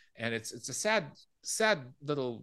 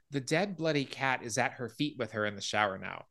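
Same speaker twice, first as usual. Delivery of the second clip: ordinary talk, nothing marked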